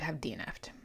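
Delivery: whispered admission; a whispered confession. Real, not acted.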